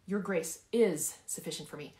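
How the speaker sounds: "is" punched firmly mid-sentence; emphatic "is"; stress on IS. Shown as a demonstration. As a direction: insist on "is" as a settled fact. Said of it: "I want you to emphasize each word".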